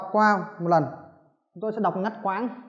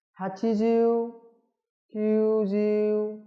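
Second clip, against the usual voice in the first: teacher modelling numbers slowly; slow drill; 2 semitones higher, much slower than usual. Shown as a demonstration. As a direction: drag each number out for students to copy. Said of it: "tôi sẽ đọc".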